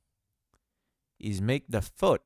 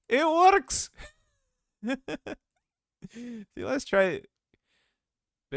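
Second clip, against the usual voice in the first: much higher and louder, gleeful squeal; thrilled; 14 semitones higher, 6 dB louder, slower than usual. Real, not acted. Tutor sees the walk cycle work, squealing with delight. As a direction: celebrate like you just cracked it. Animation finally works, self-praise.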